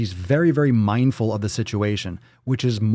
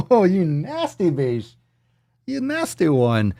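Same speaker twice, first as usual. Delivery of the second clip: playful teasing; 7 semitones higher, slower than usual. Pretend. Teasing a viewer's fart comment.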